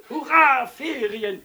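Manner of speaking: high, loud jubilant cheer; gleeful shout; children's whoop of joy. A narrator's reading. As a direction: whoop like kids let out of school. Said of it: "juchzten die Kinder".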